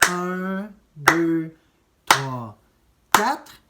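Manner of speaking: "Un, deux, trois, quatre" counted loud, one per beat; counting the beat. Shown as a demonstration. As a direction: call out each beat. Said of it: "mon temps, c'est comme ça".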